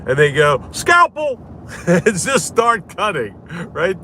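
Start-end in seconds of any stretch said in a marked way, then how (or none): 0.7-1.4 high clipped mock order for the scalpel; mocking a doctor who skips diagnosis, high mock order; mock surgeon. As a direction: play a surgeon who cuts at once.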